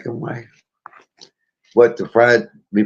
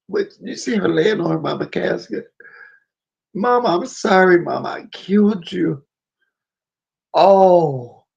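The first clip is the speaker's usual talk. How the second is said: tearful, raised pitch; crying; 6 semitones higher than usual. Real, not acted. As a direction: cry through the retelling. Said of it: "I cry every time".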